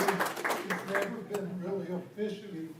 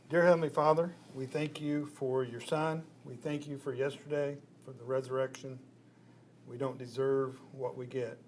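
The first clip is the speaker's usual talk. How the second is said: prayer; 3 semitones lower than usual. Real, not acted. Leads the opening prayer.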